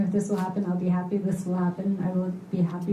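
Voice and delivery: ordinary talk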